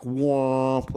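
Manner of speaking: drawn-out honk of a truck horn; imitated truck horn. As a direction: blare it out like a horn. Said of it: "like, loud horn".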